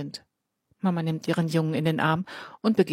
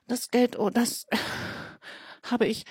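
nervous stammer, a little higher; 6 semitones higher, 3 dB quieter than usual. Acted. Elias caught lying to the boys, stammering nervously. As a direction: stumble over the words, caught out. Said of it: "Jetzt gerät Elias ins Stottern".

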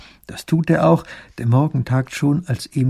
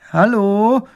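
high, loud call into the silence; a questioning shout toward the hut; 9 semitones higher, 6 dB louder than usual. A narrator's reading. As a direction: call out to see if anyone's home. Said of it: "ruft Emil".

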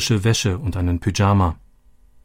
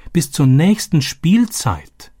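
higher, slow, falling in dismay; dismayed echo; 7 semitones higher, 3 dB louder, slower than usual. A narrator's reading. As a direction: let the dread sink in. Narrator echoes dawning realisation. (bestürzt, höher, langsam und fallend)

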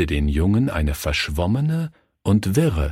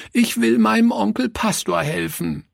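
put-on high-pitched tearful child's plea; sobbing cry. A narrator's reading. Leni wanting to help Uncle Pastor, tearfully crying. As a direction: plead high like an upset little girl. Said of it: "rief sie schluchzend".

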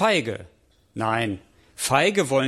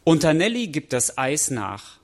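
ordinary delivery

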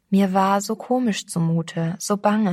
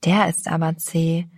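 ordinary delivery, nothing marked

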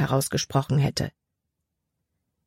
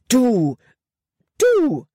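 sputtered, climbing higher with fury; sputtering anger; 8 semitones higher, 5 dB louder than usual. A narrator's reading. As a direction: spit the words out in rage. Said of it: "sprühte sie".

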